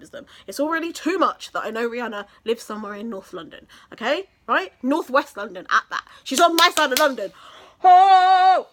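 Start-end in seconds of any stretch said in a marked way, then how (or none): 6.2-8.7 louder, rising squeal of fangirl excitement; gushing excitement. Real, not acted. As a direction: gush about her, then squeal. Gushing over [name].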